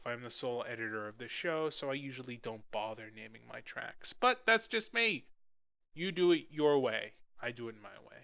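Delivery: normal talking voice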